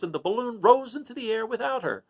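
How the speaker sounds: ordinary delivery, nothing marked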